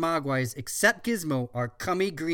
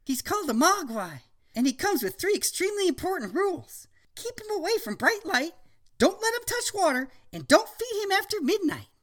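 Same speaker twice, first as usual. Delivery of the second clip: put-on much higher, earnest little boy; child voice; 14 semitones higher than usual. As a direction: warn like a serious kid.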